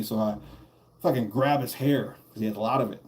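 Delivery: usual speaking voice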